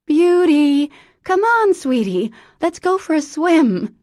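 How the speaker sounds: high, loud forced-cheerful call; calling out again. Acted. Mother tries again calling her dog, loud and high. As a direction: shout brightly, masking panic. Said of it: "she tried again".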